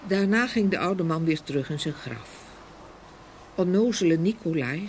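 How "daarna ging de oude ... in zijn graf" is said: usual speaking voice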